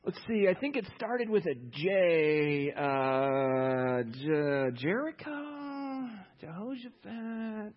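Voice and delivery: slow, drawn-out searching for a name, pitch rising; clueless fumbling. Retold or imitated. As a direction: stretch the J names out like a dim guess. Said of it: "He's like".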